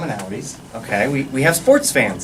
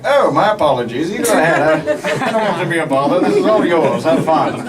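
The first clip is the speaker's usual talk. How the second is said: lightly voiced, apologetic little brother; politely bow out of the play; overly polite kid; 2 semitones higher than usual. Brother backing away from the soccer ball, mildly exaggerated politeness. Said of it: "step back and be like".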